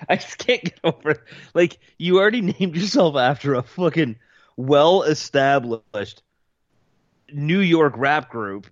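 can't stop laughing at it; laughing disbelief. Real, not acted.

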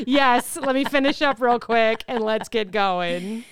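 normal talking voice

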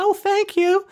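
very high-pitched celebrity impression; Tiffany Pollard. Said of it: "worst impression of Tiffany Pollard".

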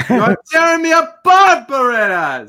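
high, loud anguished Tommy Wiseau cry; Tommy Wiseau impression. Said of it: "You do a really good Tommy Wiseau".

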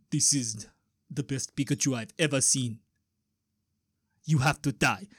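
put-on low, halting African accent; deep African voice. African criminal passing sentence over Pikachu, deep African accent. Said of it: "then the African guy goes".

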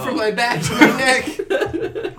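loud high slow laughing cry; laughing high complaint. Acted. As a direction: whine it laughing. Dying complaint.

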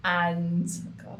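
ordinary talk

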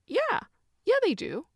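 high, loud exaggerated yeah; emphatic yes. Real, not acted. Confessing to viewers that her hips hurt, emphatic high yeah.

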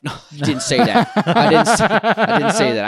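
laughing through the words; amused, breaking up; laughing denial. Real, not acted. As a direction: protest through a fit of giggles. Laughing denial of own joke.

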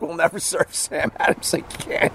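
mock indignant bar owner, high and soft; angry bar owner. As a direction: play the offended bar owner vowing a boycott.

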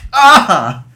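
high sharp cry of pain; just got slapped. Real, not acted. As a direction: yelp as the slap lands. Slapped again.

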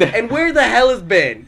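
loud, high demand to know; exasperated yell, acting out the row; retold angry shout. Storyteller asking after his missing friend, exasperated. Retold quarrel over kayaks.

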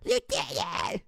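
high, strained mock scream, held back in volume; imitated scream. Imitating a teammate killed while the lieutenant froze, strained mock scream. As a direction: scream it thinly without full volume. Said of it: "she screams at the top of her lungs".

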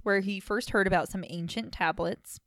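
ordinary talk, nothing marked

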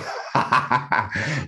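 a high, real burst of laughter; laughing. Real, not acted. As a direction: laugh at your own joke. Laughs at own wish.

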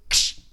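sharp loud snapping noise; loud cracker snap. Acted.

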